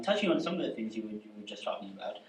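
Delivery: normal talking voice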